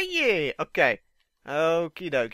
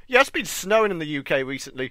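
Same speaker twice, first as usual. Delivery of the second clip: usual speaking voice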